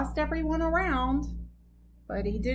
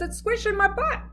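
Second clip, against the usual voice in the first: high, whiny repeat; whiny complaint; 9 semitones higher than usual. Retold or imitated. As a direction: whine about the squeeze. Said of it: "and he says".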